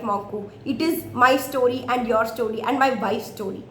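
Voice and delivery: plain reading voice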